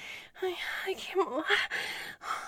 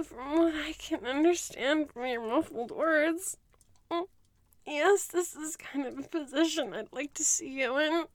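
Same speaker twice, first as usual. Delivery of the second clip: normal talking voice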